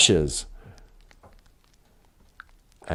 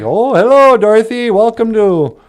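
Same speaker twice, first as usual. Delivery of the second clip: high, loud, sing-song welcoming voice; sweet good witch; 6 semitones higher, 5 dB louder than usual. Retold or imitated. The bubble lady welcomes Dorothy, high and sing-song. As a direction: greet her like a gushing fairy. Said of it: "She's like".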